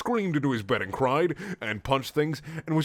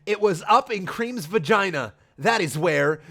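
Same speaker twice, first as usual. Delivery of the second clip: higher, louder put-on accusation; mock accusing hero; 8 semitones higher, 5 dB louder than usual. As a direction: spit it out hammily. Sonic accusing Amy.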